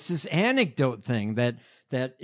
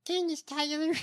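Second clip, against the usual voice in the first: high-pitched, put-on politician voice; Candace Taylor in candidate's voice; 11 semitones higher, 4 dB quieter than usual. Co-host trying candidate's voice on Candace Taylor, high mimicry. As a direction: say the name in a high caricature voice. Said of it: "With that voice?".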